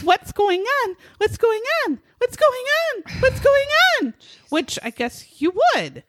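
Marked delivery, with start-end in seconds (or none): 0.0-4.1 repeated question climbing higher each time; rising more frantic with every repeat; building panic. Retold or imitated. [name] quoting her confused panic at police, climbing higher.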